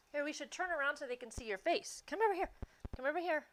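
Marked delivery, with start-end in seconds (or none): 2.1-3.5 calling a dog. Real, not acted. Calling her dog over.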